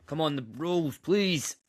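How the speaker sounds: high excited plea, rising; pleading cheer. Real, not acted. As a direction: beg the team to come through. Begging his team to win.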